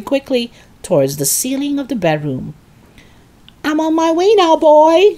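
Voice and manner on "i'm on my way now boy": high, loud triumphant holler; calling down. A narrator's reading. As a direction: holler down to the boy below. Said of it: "she called down to George".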